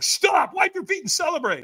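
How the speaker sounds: loud, very high urgent order; imitating excited helpers yelling instructions; catchers' shout. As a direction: shout it at someone charging past. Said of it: "And they're like".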